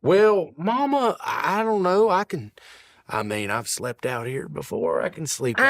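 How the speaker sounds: a hint of a flustered husband; hesitant old papa.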